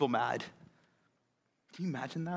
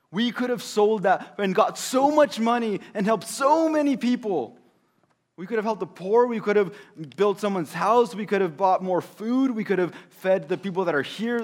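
higher and louder, listing complaints; indignant Judas; 4 semitones higher, 6 dB louder than usual. Acted. Judas complaining to the disciples, indignant. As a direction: hammer home every missed good deed.

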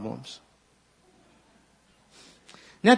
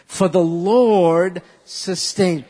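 loud, slow line; slow recitation; 7 dB louder, much slower than usual. Shown as a demonstration. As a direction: lead them through it. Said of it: "Say it with me".

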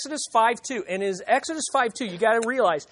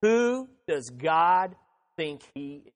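scornful challenge; 5 semitones lower, slower than usual. Acted. Voicing the objector's scorn toward God, slow and sneering. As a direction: let the contempt drag out the words. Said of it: "is the attitude".

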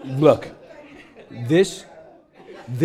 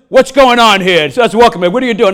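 raised, indignant questioning; retold loud demand; 6 semitones higher, 8 dB louder than usual. Lloyd walking in on the screaming, loud indignant demand. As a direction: demand an explanation, loud and pitched up.

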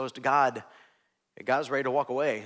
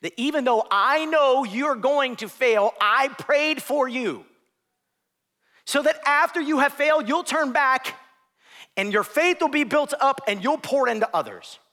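fervent Jesus; 9 semitones higher, 4 dB louder than usual. Acted. Jesus urging Peter he prayed for him, loud and earnest. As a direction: proclaim it with rising fervour.

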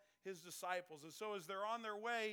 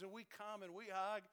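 ordinary talk, nothing marked

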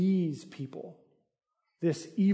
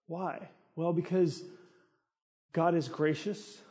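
ordinary talk, nothing marked

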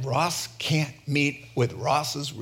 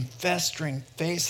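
ordinary talk, nothing marked

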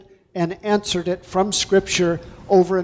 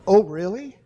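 surprised oh really. Shown as a demonstration. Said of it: "as in".

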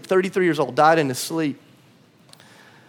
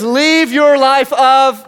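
slow, loud, raised command; firm slow command; 5 semitones higher, 5 dB louder, much slower than usual. Acted. Preacher quoting Jesus to the church, firm slow command. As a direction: lay the words down slowly. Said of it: "He says".

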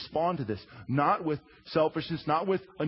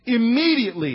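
emphatic; 6 semitones higher, 7 dB louder than usual. Real, not acted. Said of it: "how fast?".

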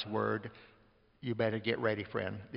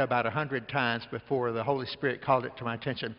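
normal talking voice

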